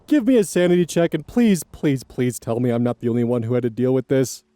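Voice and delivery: ordinary talk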